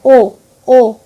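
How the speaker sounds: loud, emphatic ও ও; phonics sound. Shown as a demonstration. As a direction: call out the ও sound for the child. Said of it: "ও এর উচ্চারণটা হবে ও". (জোরে ও ধ্বনি)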